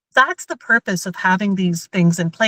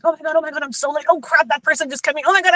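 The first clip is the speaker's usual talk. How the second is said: hammed-up flustered driver, higher; mock panic; 7 semitones higher, much faster than usual. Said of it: "we're speeding and we're like".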